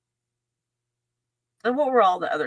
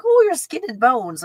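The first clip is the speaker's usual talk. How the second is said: higher, louder mock Italian mother; fussing Italian mom; 7 semitones higher, 6 dB louder than usual. Italian mom trying to feed her guest, mock fussing. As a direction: fuss over a skinny guest. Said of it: "I call it an Italian mom".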